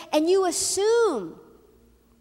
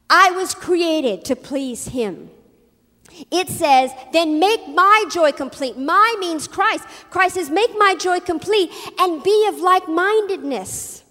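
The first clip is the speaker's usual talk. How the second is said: loud, driving sermon delivery; forceful preaching; 3 semitones higher, 7 dB louder, slower than usual. Real, not acted.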